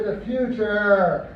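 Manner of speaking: "future" drawn out slowly with a long ending; drawn-out pronunciation. Shown as a demonstration. Comic telling crowd how he says it, drawn-out "future". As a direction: stretch the end of "future" right out. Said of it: "I say it like that".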